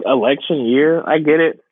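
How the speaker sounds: ordinary delivery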